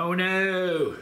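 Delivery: gasping at the chili heat; reacting to the burn; mouth burning from spicy food. Real, not acted. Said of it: "my ear just started burning".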